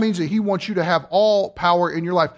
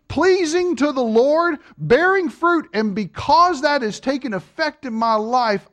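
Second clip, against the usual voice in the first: earnest prayer; 5 semitones higher than usual. Shown as a demonstration. Preacher praying aloud for the church, fervent and raised. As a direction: pray it out for the congregation to hear.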